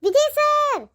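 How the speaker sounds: high frantic shout for help; calling for help. Acted. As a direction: cry out to be rescued. She calls to Vijay for help.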